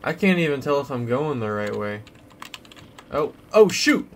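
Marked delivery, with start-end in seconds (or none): 3.1-4.1 startled. Real, not acted. Sudden danger in game.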